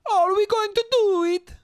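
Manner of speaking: very high, squealed mock Italian accent; exaggerated Italian accent. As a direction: do a messed-up, over-the-top Italian accent. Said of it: "that's the thing about Italian accents".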